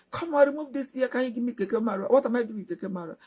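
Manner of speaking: put-on a spoiled girl turning up her nose at the gift; indignant girl.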